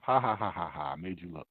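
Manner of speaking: low, quiet spoken taunt of ha ha ha; mocking made-you-look laughter under the breath. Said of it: "they sit back and laugh".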